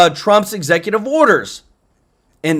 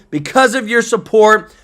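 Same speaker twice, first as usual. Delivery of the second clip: ordinary delivery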